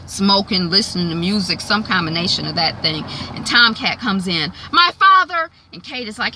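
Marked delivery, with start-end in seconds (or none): 4.7-5.5 loud, high-pitched exclamation; acted indignant shout; demanding outburst. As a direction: burst out with it, confronting your mother. Acting Tomcat's confrontation with his mother.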